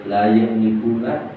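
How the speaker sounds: slow, melodic chanted recitation; Quran recitation. Acted. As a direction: draw each phrase out in reverent chant. Quran verse recited in sermon.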